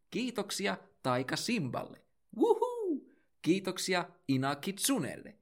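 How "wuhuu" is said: whoop. Acted. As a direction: cheer like a fan at a concert. Cheer after each patron thanked.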